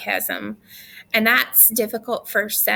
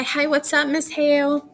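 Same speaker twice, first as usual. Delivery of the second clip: breezy, chummy hello, a bit higher; too casual greeting; 5 semitones higher than usual. Retold or imitated. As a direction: greet the teacher like a buddy. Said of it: "Don't say".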